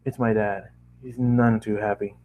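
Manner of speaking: ordinary delivery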